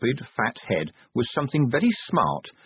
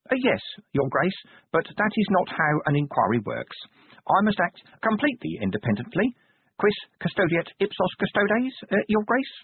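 a fussy little clerk, higher and polite; timid but insistent official; prim inspector; 9 semitones higher than usual. A narrator's reading. Inspector explaining his inquiry to the Duke, prim and fussy.

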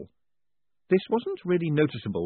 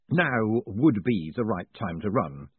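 slow inner thought; slower than usual. Acted. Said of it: "Moist's hindbrain thought".

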